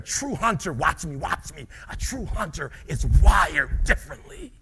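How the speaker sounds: rising preacher's shout; true hunter. Shown as a demonstration. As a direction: call it out with hunger. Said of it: "I'm gonna show you".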